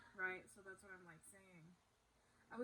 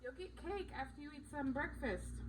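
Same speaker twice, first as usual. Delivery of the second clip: higher-pitched parental promise; 6 semitones higher than usual. Real, not acted. Parent answering a child during filming, higher child-directed voice.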